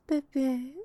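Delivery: usual speaking voice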